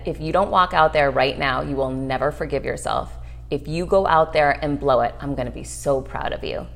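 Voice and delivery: low, soft words to herself; quiet self pep talk. Retold or imitated. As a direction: coach yourself under your breath. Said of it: "And I said".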